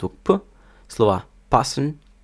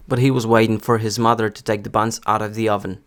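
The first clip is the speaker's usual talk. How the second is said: standard American accent; американська вимова; faster than usual. Shown as a demonstration. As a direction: read it in General American, r's sounded. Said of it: "друга буде стандартна американська вимова". (стандартна американська вимова)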